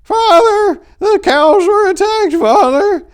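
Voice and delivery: put-on high, loud, frantic old woman's wail; old lady crowing. A narrator's reading. Old lady to the priest, crowing in panic. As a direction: crow it out like a panicked old farm wife. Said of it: "the old lady crowed".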